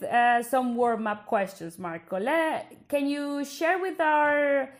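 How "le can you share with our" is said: ordinary talk, nothing marked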